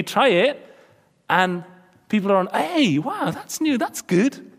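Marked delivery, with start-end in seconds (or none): 2.5-4.5 mock impressed friends. Imitating people admiring new style.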